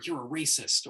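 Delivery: hammed-up outraged accusation; mock accusation. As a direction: throw the accusation at them. Mock accusations thrown at speaker.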